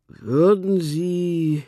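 slow, drawn-out call upward. A narrator's reading. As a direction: call to a bird circling overhead. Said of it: "rief er zum Basstölpel hinauf".

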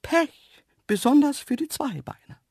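very high, loud dainty creature voice; huffy antelope. A narrator's reading. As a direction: throw a snooty parting shot in a high voice. Offended antelope's parting remark. (schnippische, hohe Antilopenstimme)

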